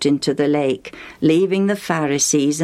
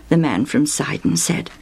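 ordinary delivery, nothing marked

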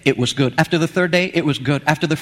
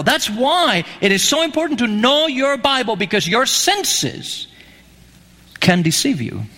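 normal talking voice

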